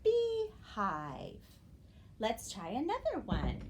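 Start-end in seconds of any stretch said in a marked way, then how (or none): 0.0-1.4 "beehive" with a long high bee, then a falling hive; teacher sounding out "beehive", high then falling; syllable blending demo. Shown as a demonstration. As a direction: stretch "beehive" into two distinct syllables. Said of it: "Our new word is".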